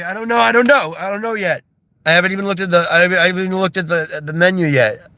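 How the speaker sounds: high, stammering overwhelmed customer; mock flustered. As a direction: stumble over yourself in played-up panic. Flustered customer pressed to order.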